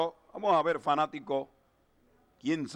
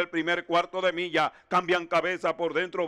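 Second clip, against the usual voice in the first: normal talking voice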